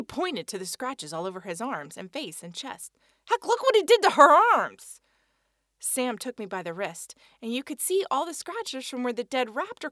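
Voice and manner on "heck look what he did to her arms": indignant exclaim. Acted. Eddie points at Amy's arms.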